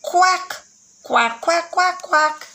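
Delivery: high quacking duck calls; duck quacks. Shown as a demonstration.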